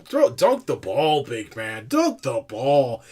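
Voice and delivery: slow, drawn-out over-the-top announcer voice; mock Bill Walton commentary. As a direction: ham up a gushing TV commentator, stretching every word.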